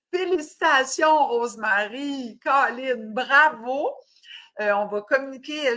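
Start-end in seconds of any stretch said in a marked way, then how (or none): none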